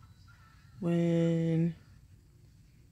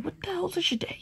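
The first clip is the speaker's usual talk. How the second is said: higher, breathy whisper; mock whisper; 11 semitones higher, 5 dB quieter than usual. Mocking her own name to the camera in a whisper. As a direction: hush it out like a disbelieving aside.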